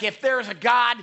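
imitated angry shout, high and loud; acted-out furious challenge. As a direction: shout it as a defiant dare to heaven. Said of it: "And he starts shouting".

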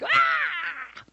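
high, drawn-out shriek; demon shriek. Acted. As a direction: let out a piercing demonic scream. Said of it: "with a shriek".

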